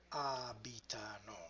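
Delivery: "abitano" soft, stressed A-bi-ta-no; soft, careful model of "abitano", first syllable stressed; stress on fourth-last syllable. Shown as a demonstration. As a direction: lean on the opening "a" of "abitano", syllable by syllable. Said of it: "the fourth to the last syllable".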